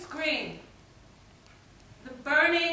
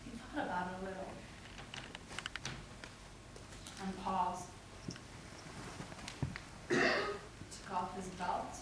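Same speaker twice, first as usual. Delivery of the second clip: low, soft and halting; hushed menace; 6 semitones lower, 12 dB quieter than usual. Acted. Poet recounting him pausing before the belt, ominously quiet. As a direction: drop low and let the dread build.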